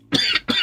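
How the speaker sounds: sharp tickly cough; short dry cough. Real, not acted. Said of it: "tickly cough kicked in".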